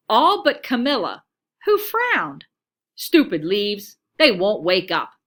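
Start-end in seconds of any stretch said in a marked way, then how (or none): none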